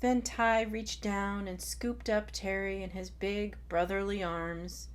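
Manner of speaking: plain reading voice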